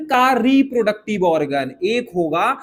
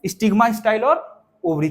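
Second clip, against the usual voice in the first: ordinary talk, nothing marked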